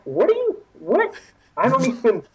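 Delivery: high-pitched, flustered stammering; acted-out bewilderment; baffled protest. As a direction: sputter in disbelief, voice jumping up. Retelling his baffled protest to officer.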